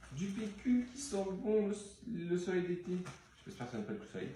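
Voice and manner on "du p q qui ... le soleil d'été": high mock commercial voice. Vlogger showing toilet paper, playing a mock commercial. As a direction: sell it like a cheesy ad, pitched up.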